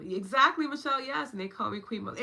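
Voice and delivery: high and loud, cheering the comment on; excited agreement. Real, not acted. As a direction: call out yes like good news.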